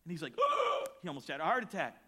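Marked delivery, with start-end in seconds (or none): 0.4-1.0 a sharp high gasp of surprise; imitated shocked gasp. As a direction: gasp as if hearing shocking news. Counselor shocked at news.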